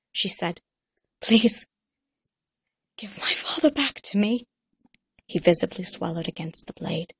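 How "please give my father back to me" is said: higher, loud then whispered; beg, then break into a whisper; desperate plea fading to whisper. Acted. Cullen to Rune, higher plea fading to a whisper.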